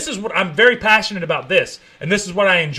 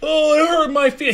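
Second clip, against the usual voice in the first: 1 whiny mock; 9 semitones higher than usual. Mocking a whiny complainer.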